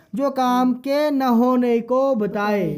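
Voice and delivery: slow, word by word, جو کام کے نہ ہونے کو بتائے spaced out; slow teacher's dictation. Shown as a demonstration. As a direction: spell out the definition slowly for the student. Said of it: "فعل منفی کس کو بولتے ہیں؟".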